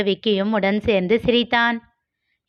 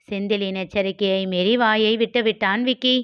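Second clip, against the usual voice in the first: plain reading voice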